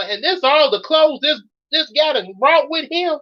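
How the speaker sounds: fed-up rant. Acted. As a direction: pile on the disgust at his packing. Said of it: "Mary Lou has gotten so mad".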